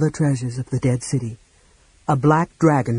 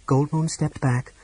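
usual speaking voice

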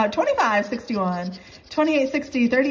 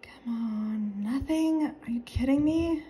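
ordinary talk, nothing marked

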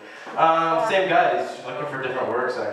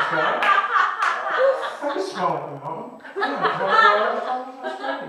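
high, drawn-out Owen Wilson; Owen Wilson impression; 10 semitones higher than usual. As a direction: stretch words like Owen Wilson. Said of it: "How about Owen Wilson?".

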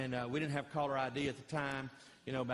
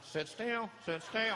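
a hint of a wife's high shushing; lightly voiced wife, higher, hushing her man; 7 semitones higher, slower than usual. Wife to fidgeting husband in church, hushing higher. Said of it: "your wife's going".

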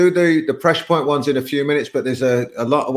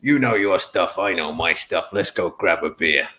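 put-on low-pitched go at a Chicago accent; Tony, attempted accent; 4 semitones lower than usual. Friend retelling his Chicago coach's line, attempted accent. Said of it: "I can't do the accent, but I'll try it".

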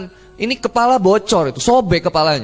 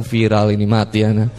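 much lower comic resignation; low deadpan aside; 13 semitones lower than usual. Retold or imitated. Passenger reacts to the call for prayer, low deadpan. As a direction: drop the pitch right down.

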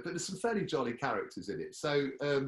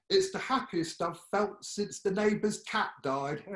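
much higher, louder fussy birdwatcher voice; nurse Timothy; 8 semitones higher, 4 dB louder than usual. Acted. Nurse Timothy gushes about a rare bird, high and prim. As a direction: chirp it out, high and delighted. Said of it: "then we have Timothy".

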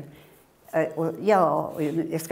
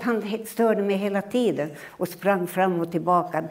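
ordinary talk

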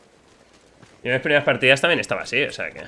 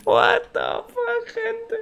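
very high, slow stunned squeal; squealing disbelief; much slower than usual. Real, not acted. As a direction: shriek it in disbelief.

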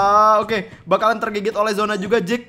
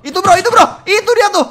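excited shout; 11 semitones higher, 8 dB louder than usual. Real, not acted. Caster spots the enemy.

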